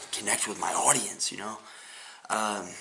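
ordinary talk

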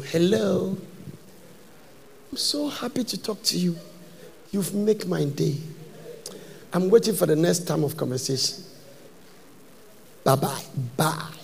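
softly cooing woman on the phone; a hint of a flirty caller, sugary; sweet phone voice. Preacher mimics a woman flirting on the phone, sugary soft. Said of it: "They have a certain voice on the phone".

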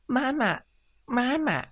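tiny, very high duckling voice; coquettish baby duckling. Acted. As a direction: call for mama in a cutesy baby voice. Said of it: "撒娇地对着葱花母鸡说".

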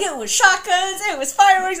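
high, loud gushing; hammed-up swoon; swooning excitement. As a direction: gush like a lovestruck boy. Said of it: "he's like".

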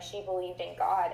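normal talking voice

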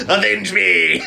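loud high dying cry; overacted death scene. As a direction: ham it up like a dying hero. Acting Spider-Man movie death scene.

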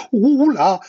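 high, loud owl's cry; alarmed exclamation. A narrator's reading. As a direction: hoot out in fright. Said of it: "s'exclama-t-il".

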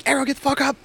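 high, loud barked order; drill sergeant yell. Retold or imitated. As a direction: bark it like a drill instructor waking recruits. Drill sergeant barking at recruits.

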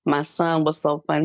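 usual speaking voice

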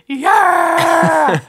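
high joyful yell; excited shout. Retold or imitated. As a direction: shout it out in triumph. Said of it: "that's what I'm like".

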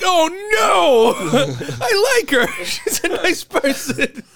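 very high, whiny near-cry with laughter; mock dismay. Lamenting his roommate's doomed date, mock-tearful dismay. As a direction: wail it in hammed-up sympathy.

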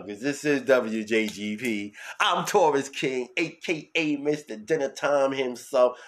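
loud, slow announcer voice; radio host intro. Acted. Taurus King introducing himself to listeners, slow loud announcer. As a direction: announce yourself like a radio star. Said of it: "host persona announcing himself".

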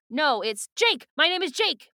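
loud, high-pitched outburst; acted shout. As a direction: shout the name out in protest. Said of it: "he, like, shouts them out loud".

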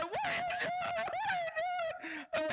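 very high, teary, wailing plea; crying protest. Retold or imitated. Boy crying while mom scrubs his wound, high teary wail. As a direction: act out the kid sobbing in pain. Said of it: "And I'm crying".

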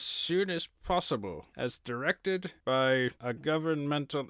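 slow, grand stage actor; thespian voice. Pretend. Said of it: "Use your thespian voice".

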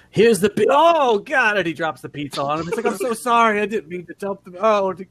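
high, loud panicked apology; flustered panic. Acted. Pizza guy panics after dropping the pizza on him.

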